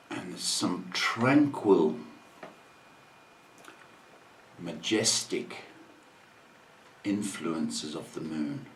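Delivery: calm reflection. Acted. Telling listeners about the moon, tranquil. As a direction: drift through it quietly.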